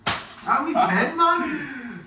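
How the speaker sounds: mock voice. Mocking, singsong voice at friend.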